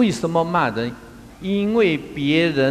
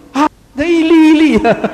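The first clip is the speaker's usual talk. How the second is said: 兴奋大喊; 11 semitones higher, 11 dB louder than usual. Real, not acted. 讲到菜切成粒.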